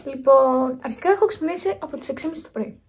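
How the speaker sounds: usual speaking voice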